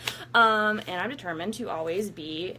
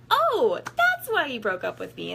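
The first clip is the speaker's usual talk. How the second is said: high, loud sudden "oh" of discovery; mock realization; 6 semitones higher, 5 dB louder than usual. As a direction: act out the penny dropping. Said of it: "And I was like".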